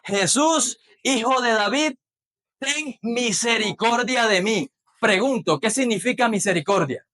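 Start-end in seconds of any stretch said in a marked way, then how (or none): 0.0-4.7 a hint of a pleading cry, slow at the end; blind man's plea. Blind Bartimaeus crying out to Jesus, mildly pleading. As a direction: plead lightly as the blind beggar. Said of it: "¿Qué decía?".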